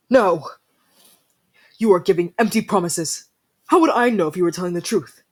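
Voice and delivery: loud angry rejection; angry outburst. Acted. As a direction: throw the promises back at them. Angry refusal of promises.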